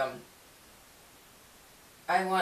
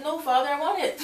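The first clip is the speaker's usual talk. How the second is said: put-on high, childlike begging; whiny pleading; 10 semitones higher, 4 dB louder than usual. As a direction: plead like a kid who wants the treat. Pleading with God over cookies.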